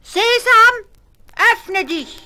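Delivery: very high, loud daring call; Ali Baba calling out. A narrator's reading. Ali Baba trying the robbers' magic words, high call. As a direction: call out boldly, testing the magic. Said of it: "hat gerufen".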